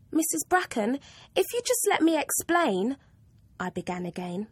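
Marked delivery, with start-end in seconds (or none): 0.1-3.0 put-on higher, earnest girl's plea; a girl begging to be heard; pleading schoolgirl. Pupil pleading with Missus Bracken, higher anxious girl.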